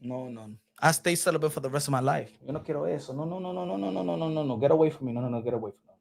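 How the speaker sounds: low, soft, rapid-fire pleading; muttered refusal. Pretend. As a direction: mutter it as if backing away in dread. Acting out refusal, recoiling from her.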